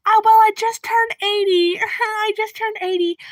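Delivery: put-on high, oblivious old-lady voice; imitation of a tickled old lady; cheerful elderly neighbor. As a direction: announce it proudly in a high grandma voice. Acts elderly neighbor changing the subject.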